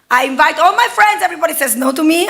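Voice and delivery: ordinary talk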